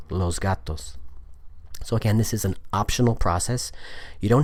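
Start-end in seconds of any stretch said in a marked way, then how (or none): 0.1-1.0 "los gatos" with S buzzed as Z; assimilated pronunciation. Shown as a demonstration. Teacher putting zg back into the phrase, "los gatos" with Z. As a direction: link "los gatos" through a voiced Z into G. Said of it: "So it's zg".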